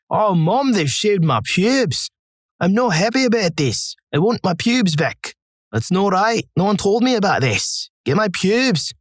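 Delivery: high, drawn-out broad Scottish shout; broad Scottish accent. Retold or imitated. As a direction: play it as a livid patient yelling in a broad Scottish accent. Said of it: "shouting in my broad Scottish accent".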